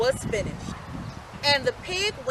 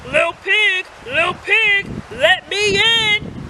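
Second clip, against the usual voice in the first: higher, louder wolf, very slow at the end; wolf growling; 7 semitones higher, 7 dB louder, slower than usual. A narrator's reading. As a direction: demand entry, hungrier now. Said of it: "he growled".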